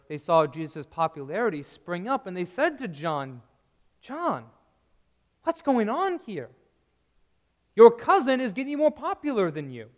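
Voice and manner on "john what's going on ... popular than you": alarmed. Acted. Disciples alarmed by Jesus' popularity.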